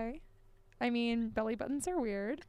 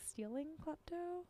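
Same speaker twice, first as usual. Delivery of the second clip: ordinary talk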